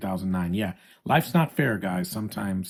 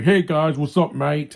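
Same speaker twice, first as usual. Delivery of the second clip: put-on loud, higher-pitched Australian accent parody; hammed-up Aussie greeting, pitched up and loud; mock Australian accent; 5 semitones higher, 7 dB louder, slower than usual. Mocking Optimus's slipped accent, exaggerated Australian. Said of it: "an Australian accent".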